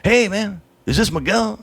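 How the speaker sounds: a cheery stranger on the phone; Valley caller. Retold or imitated. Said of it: "they're from the Valley".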